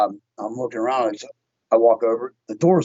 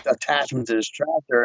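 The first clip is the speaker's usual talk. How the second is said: normal talking voice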